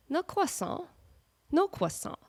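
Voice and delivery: croissant said kwah-SAH, W, no R, no T; mock the fancy kwah-SAH; mock French croissant. Shown as a demonstration. Vlogger mocking the Frenchified croissant. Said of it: "Don't stick a W in there".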